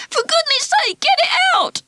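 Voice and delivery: very high frantic squeal, rising to a shriek; frantic plea. Acted. Ralph to everyone, frantically begging with the mouse stuck. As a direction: beg desperately, near hysterical.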